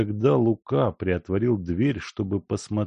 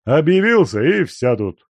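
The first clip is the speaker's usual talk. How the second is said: loud, clipped gruff answer; curt reply; 7 semitones higher, 8 dB louder than usual. A narrator's reading. Guest curtly refusing to explain, loud and short. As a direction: shut the question down. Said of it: "коротко сказал Данила Семёныч".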